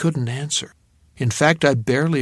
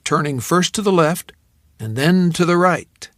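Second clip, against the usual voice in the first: usual speaking voice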